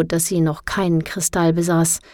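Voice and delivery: ordinary delivery, nothing marked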